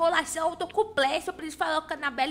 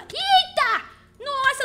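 startled shout; 7 semitones higher, 7 dB louder, much slower than usual. Real, not acted. Sudden scare in game.

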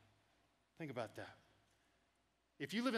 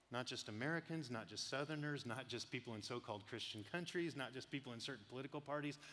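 each "not just" hit, softer and intense; insistent list; 5 semitones lower, 8 dB quieter than usual. Real, not acted. Preacher to congregation, quiet emphatic list. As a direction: press every item home, quietly. Listing groups to make a point.

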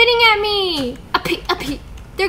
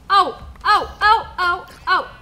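ordinary talk, nothing marked

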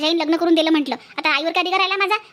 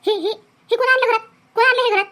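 angry demand; 5 semitones higher than usual. Acted. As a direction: scold the bride for bringing meat home. Mother-in-law discovers meat dish.

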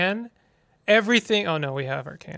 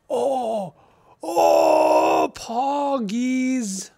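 excited gasp; 6 semitones higher, much slower than usual. Real, not acted.

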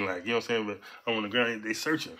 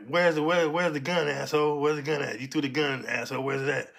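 loud, higher demanding interrogation; angry cop demand; 4 semitones higher, 4 dB louder than usual. Retold or imitated. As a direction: shout the question at the suspect. Cops demanding where gun is.

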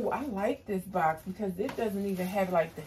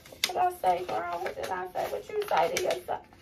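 high playful voice; 12 semitones higher than usual. Pretend. Quizzing herself in playful voice.

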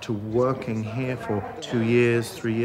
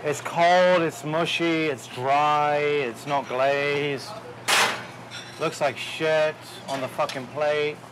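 higher, listing every fault with disgust; angry critique; 3 semitones higher, slower than usual. Real, not acted. Chef to cooks over a bad scallop plate, disgusted and angry.

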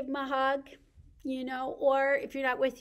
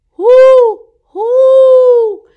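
high, loud owl hooting; 13 semitones higher, 27 dB louder than usual. Pretend. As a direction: hoot out like a storybook owl. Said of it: "Mama owl hoots".

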